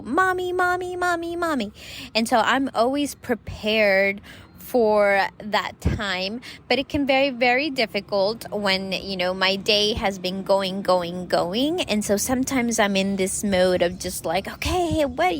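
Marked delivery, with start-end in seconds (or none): 0.0-1.7 put-on whiny kid calling. 14.5-15.4 higher, hurried mock frantic reply. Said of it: "of, like, frantic".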